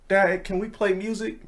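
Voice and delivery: put-on high small-child voice, asking softly; little boy asking from the back seat; kid voice.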